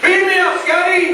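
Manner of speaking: high, loud hammed-up cry for rescue; mock desperate shout. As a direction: yell it like a stranded starship captain. Shouting from inside the tube.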